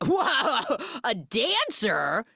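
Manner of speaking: hammed-up high whoa, drawn-out last word; mock impressed. Driver teasing her about being dancer, mock impressed. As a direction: imitate a teasing, over-impressed reaction, slowing down. Acts out man's teasing reaction.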